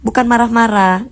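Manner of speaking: ordinary delivery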